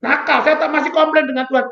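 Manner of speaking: normal talking voice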